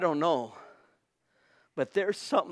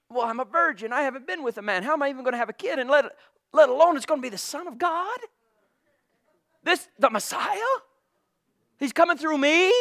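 incredulous Mary; 7 semitones higher, faster than usual. Acted. Acts out Mary questioning the news.

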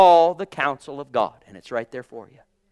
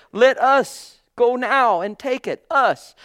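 high, loud, slow call to advance; rally the people forward; bold quote; 6 semitones higher, 5 dB louder, slower than usual. Retold or imitated. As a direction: Caleb urging the people on, pitched high and loud. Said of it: "He said".

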